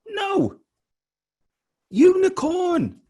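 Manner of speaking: high-pitched, drawn-out cry of amazement; astonished. Retold or imitated. Speaker revealing to listeners the national animal of Scotland, astonished. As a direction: stretch the big reveal way up high.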